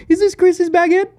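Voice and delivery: put-on high, loud French-accented question; imitated French accent. French tour manager asking about luggage, imitated French accent. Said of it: "She's like".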